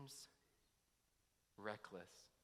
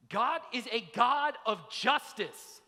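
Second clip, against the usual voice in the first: loud insistence; 8 semitones higher, 11 dB louder, slower than usual. Retold or imitated. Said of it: "they would have said".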